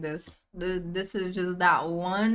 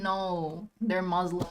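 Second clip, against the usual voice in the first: ordinary talk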